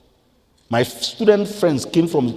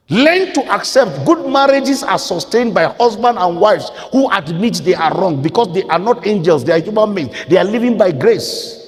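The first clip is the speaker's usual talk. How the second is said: loud, raised pulpit exhortation; full-force preaching; 6 semitones higher, 6 dB louder than usual. Real, not acted. Preacher teaches couples to admit they are wrong, preaching forcefully. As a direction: drive the teaching home with a raised voice.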